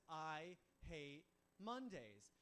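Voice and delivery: very slow, heavy complaint; Garfield. Acted. Garfield to owner as Monday rolls around, slow emphatic grumble. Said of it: "He says".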